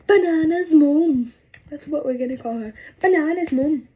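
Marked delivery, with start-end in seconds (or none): 0.1-1.3 loud, slow, stretched-out proclamation; loud nickname announcement. Real, not acted. Naming the girl's mom, loud drawn-out announcement. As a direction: proclaim it with each word drawn out.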